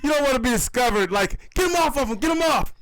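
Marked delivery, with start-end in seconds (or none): 1.6-2.7 mock shouting. Rescuers yelling at lions.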